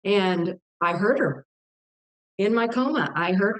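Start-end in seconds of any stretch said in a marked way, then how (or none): none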